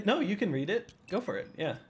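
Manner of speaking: gently condescending go-ahead; patronizing. Real, not acted. Telling a co-host to read the question, patronizing. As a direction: hand over the turn as if indulging them. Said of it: "patronizing way".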